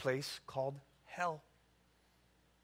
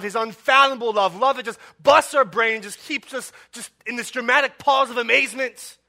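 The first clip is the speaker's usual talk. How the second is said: loud, climbing, urgent sermon voice; fiery pulpit crescendo; preaching at full force; 4 semitones higher, 12 dB louder than usual. Acted. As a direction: build the case louder with each why.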